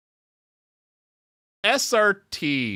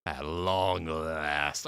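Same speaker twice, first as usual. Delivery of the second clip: much lower, very slow menacing line; villain voice; 11 semitones lower, 8 dB quieter, much slower than usual. Acted. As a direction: savour it like a schemer. Villain voice for a character.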